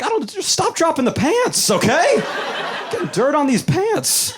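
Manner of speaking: high, exasperated boss snapping at his worker; a fed-up boss telling him to quit it; annoyed boss. Retold or imitated. Boss scolding a dreamy tailor, high and exasperated.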